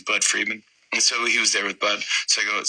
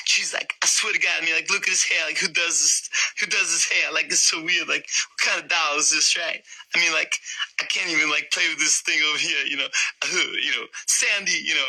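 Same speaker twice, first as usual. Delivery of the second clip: higher-pitched celebrity impression, incredulous; baffled and rambling, in a raised put-on voice; John Travolta; 7 semitones higher than usual. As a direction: impersonate a movie star puzzled by a doll. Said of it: "John Travolta".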